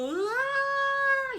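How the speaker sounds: high, loud pained scream; a retold cry of agony; labor scream. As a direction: scream out as the contraction hits. Scream as pain returns.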